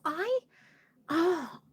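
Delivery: ordinary talk, nothing marked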